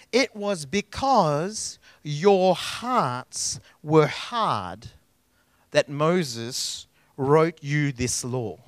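very slow, weighty, dropping low on "hard"; deliberate scripture reading; solemn scripture quote. Acted. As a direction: lean on every word like a verdict. Solemn reading of Jesus' words.

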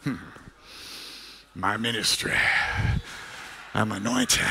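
low, quiet, self-satisfied mock voice; mock pompous preacher. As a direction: lightly parody a vain preacher. Mocking self-important preachers.

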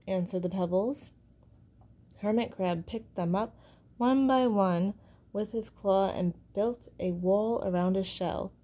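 ordinary delivery

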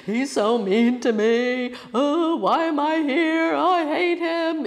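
high, drawn-out hammed-up wail; mock sobbing. Student crying alone upstairs about her teacher, exaggerated sobbing wail. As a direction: bawl it out slowly in comic despair. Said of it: "I'm sobbing".

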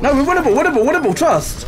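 high, loud insistent plea; rally the team, insisting; excited urging. Real, not acted. Urging team not to retreat.

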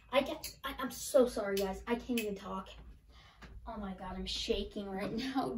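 hushed, stunned and soft; overwhelmed, speechless. Real, not acted. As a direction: speak softly, as if the shock took your voice.

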